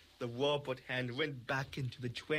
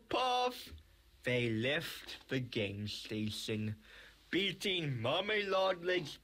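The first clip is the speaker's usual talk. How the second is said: ordinary delivery, nothing marked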